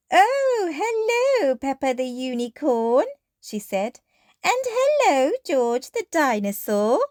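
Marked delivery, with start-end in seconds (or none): 0.1-3.1 higher, bright teacher greeting; Madame Gazelle. Acted. 4.4-7.0 higher, bright teacher greeting; Madame Gazelle. Acted.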